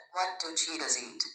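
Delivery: normal talking voice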